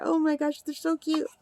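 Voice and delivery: ordinary delivery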